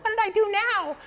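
very high squeaky mock voice; whiny little brother. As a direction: imitate a kid brother's shrill protest. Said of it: "That's how he sounded".

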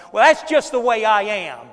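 loud, stubborn shrug of a line; loud and defiant, owning your temper; defiant excuse. Shown as a demonstration. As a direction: belt it out like an excuse you're proud of. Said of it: "we say it about like that".

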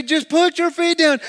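high, loud imitated yell; acted-out exasperated yell; screamed instruction. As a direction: shout over a panicking friend. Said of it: "he screams at me".

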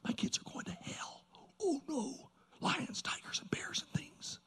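whispered dread, imitating a worried father; fearful whisper. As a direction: whisper it like an anxious prayer. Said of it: "he had this fear in his heart".

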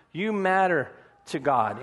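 ordinary talk, nothing marked

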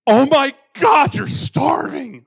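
high, slow, loud shocked gasp; shocked Sharon. Retold or imitated. Friend opening empty cupboards, shrieking in shock. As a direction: mimic a horrified friend, shrieking. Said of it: "And she's like".

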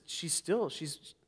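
normal talking voice

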